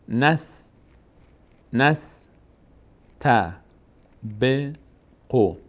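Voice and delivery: «نس، ت، ب، قو» in separate syllables with long gaps; syllable-by-syllable reading. Shown as a demonstration. Teacher sounding out a word for learners, syllable by syllable. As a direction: break it up: نس... ت... ب... قو. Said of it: "فتحهٔ نون میچسبه به سین".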